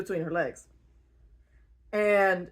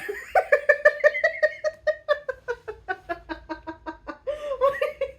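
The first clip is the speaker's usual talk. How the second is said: high burst of laughter; 3 dB louder than usual. Real, not acted. Laughing at Avatar joke.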